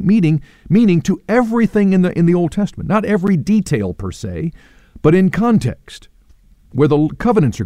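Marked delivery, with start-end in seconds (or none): none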